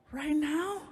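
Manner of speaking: put-on squeaky girlfriend.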